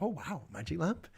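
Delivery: hushed, lightly acted wonder; quietly awed Scouser. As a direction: marvel softly at a find. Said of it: "and he's like".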